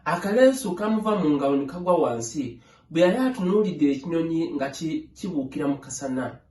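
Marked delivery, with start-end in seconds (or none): none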